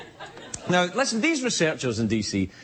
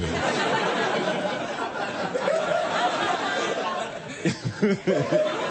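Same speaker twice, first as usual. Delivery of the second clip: laughing fit; 8 semitones higher than usual. Real, not acted. Laughs at own joke.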